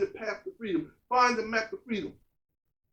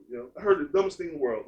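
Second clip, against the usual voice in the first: low quick aside; 8 semitones lower, faster than usual. Acted. Repeated lower and quicker.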